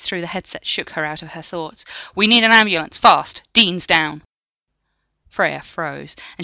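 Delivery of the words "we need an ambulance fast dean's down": loud call for an ambulance; urgent shout. Acted. Patel calling for help over the headset, loud and urgent. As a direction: yell it over the radio.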